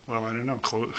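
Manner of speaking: ordinary talk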